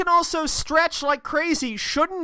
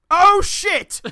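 loud, higher mock dismay; Doom; 6 semitones higher, 7 dB louder than usual. Villain realising he wrecked his car, mock dismay. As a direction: blurt it in sudden comic realisation. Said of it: "And Doom goes".

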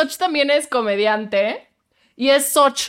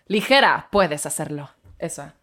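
ad voice; 7 semitones lower than usual. Shown as a demonstration. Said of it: "Esa es mi voz".

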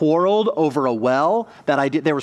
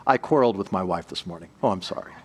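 prim, polite small talk, pitched lower; overly polite; 9 semitones lower, 4 dB quieter, faster than usual. Retold or imitated. A man politely mentions a tiff with his wife, lower prim voice. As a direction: say it like genteel British chitchat. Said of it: "it sounds really polite".

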